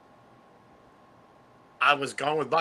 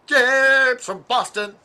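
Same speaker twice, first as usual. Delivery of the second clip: mock announcer; 8 semitones higher, 10 dB louder, much slower than usual. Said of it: "repeats name in a put-on voice".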